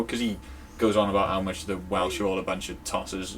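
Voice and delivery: ordinary talk, nothing marked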